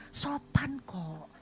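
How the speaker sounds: slow, breathless whisper of "Sopan kok"; a secret thought whispered while being polite; inner whisper. Retold or imitated. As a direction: hush "Sopan kok" slowly to yourself. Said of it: "Dalam hati".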